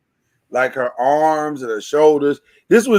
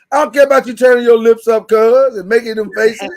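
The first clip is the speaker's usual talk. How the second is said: louder, higher, hurried telling-off; brisk, raised-voice dismissal; raised-voice scolding; 5 semitones higher, 4 dB louder than usual. Real, not acted. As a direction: talk over cuz's reaction, loud and quick. Host scolds cuz for making faces.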